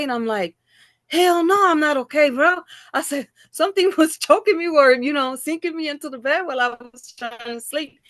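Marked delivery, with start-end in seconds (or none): 1.1-8.0 high, loud rattled reply; high, loud alarmed answer to a worried friend; shaken outburst. Retold or imitated. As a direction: blurt it out, still shaken. Telling friend about the attack.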